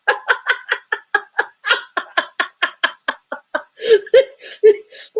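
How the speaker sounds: long, high, wheezing real laughter; helpless laughing fit. Real, not acted. Streamer reacting to a chat comment, helpless wheezing laughter. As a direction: laugh until almost no voice comes out.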